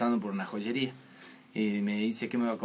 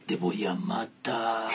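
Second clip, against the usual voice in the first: slow, low, soft threat; menacing mother; 3 semitones lower, 4 dB quieter, much slower than usual. Retold or imitated. Mother threatens her son in a slow, menacing undertone. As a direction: hiss it slowly like a mother about to explode. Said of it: "cambiaba esa dulce voz de madre".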